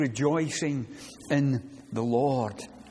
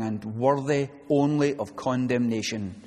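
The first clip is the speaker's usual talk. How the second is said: normal talking voice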